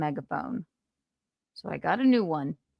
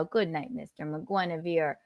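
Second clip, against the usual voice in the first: usual speaking voice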